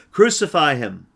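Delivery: loud, high shout of the mob; crowd's shout. Acted. The mob shouting back at Pilate. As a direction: yell it as the angry crowd. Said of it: "they shouted back".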